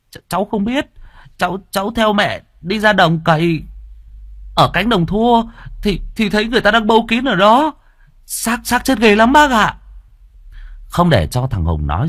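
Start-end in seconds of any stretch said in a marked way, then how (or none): 0.1-9.8 put-on high, stammering frightened child; a boy tripping over his words in fright; scared boy voice. Boy tells the chief about the corpse, high and scared.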